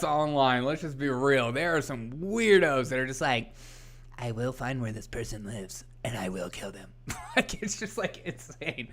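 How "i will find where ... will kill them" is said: low, very soft menacing murmur; quiet, sinister imitation of a psychopath; creepy stalker. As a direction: play an obsessive weirdo, hushed and low. Voicing creepy online stalkers.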